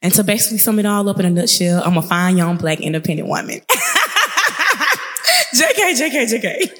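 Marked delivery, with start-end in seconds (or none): 3.6-5.5 high burst of real laughter at her own joke; laughing. Real, not acted. 5.5-6.7 "J K" said higher, through laughter; laughing. Real, not acted. Laughing retracts her boast.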